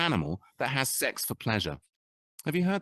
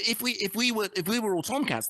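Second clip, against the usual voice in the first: ordinary talk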